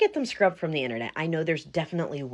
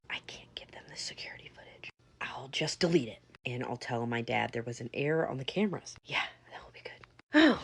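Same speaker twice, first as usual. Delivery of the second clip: whispered plan to herself; scheming whisper; 5 semitones lower, 6 dB quieter than usual. Acted. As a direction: plot it under your breath. Trisha plots alone, whispering.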